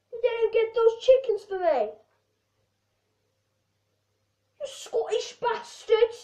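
yelling high and loud into the phone; hammed-up phone rage at a friend; mock furious rant. Acted rant at phone caller.

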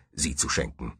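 plain reading voice

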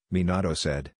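ordinary delivery

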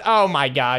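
usual speaking voice

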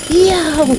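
high, loud yelp; pained squeal. Acted. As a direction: squeal like a pecked fox. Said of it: "squealed Ferdinand".

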